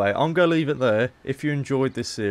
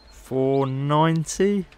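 hopeful haggle; 6 semitones higher, 4 dB louder, much slower than usual. Acted.

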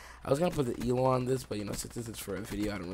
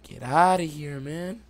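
loud taunt; 6 semitones higher, 10 dB louder, much slower than usual. Real, not acted. Taunting enemy after kill.

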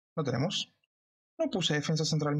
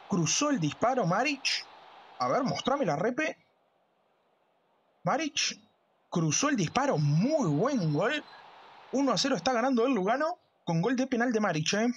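breakneck goal commentary; 7 semitones higher than usual. Acted.

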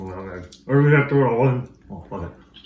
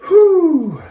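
high breathy exhale at heat; loud high whew; 7 dB louder than usual. Real, not acted. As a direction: blow out the burn. Reacting to pepper heat.

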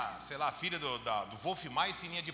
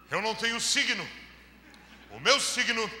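mock pious intonation; 4 semitones higher, 6 dB louder than usual. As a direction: parody a sanctimonious believer declaring the faith. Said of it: "Com essa entonação de voz e tudo". (crente carola, em tom solene e exagerado)

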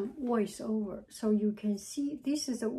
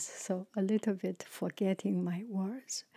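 whisper; 2 semitones lower, 3 dB quieter than usual. Real, not acted.

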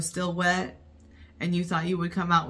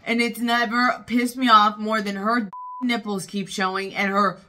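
real, loud exasperated tirade; angry rant; 3 semitones higher, 6 dB louder than usual. Real, not acted. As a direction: rant heatedly at what you just watched. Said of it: "I'm gonna really get angry".

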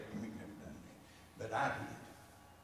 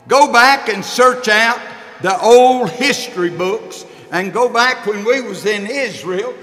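rising, louder pulpit voice; a preacher warming up the congregation; preaching; 8 semitones higher, 17 dB louder than usual. Acted. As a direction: build the parable from the pulpit. Preacher begins the parable.

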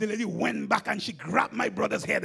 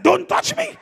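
mock pleading protest; 4 semitones higher, 11 dB louder than usual. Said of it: "I said".

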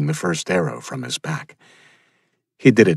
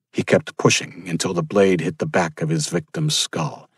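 usual speaking voice

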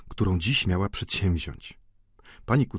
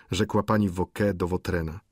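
ordinary delivery, nothing marked

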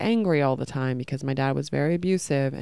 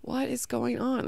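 put-on higher, slower, softer small-child voice; slow bewildered child; 8 semitones higher, 5 dB quieter than usual. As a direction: ask it like a confused little kid. Child self bewildered by father.

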